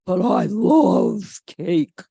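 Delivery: slow, loud, longing voice; drawn out like he can't resist; loving cake. A narrator's reading. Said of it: "George thinks".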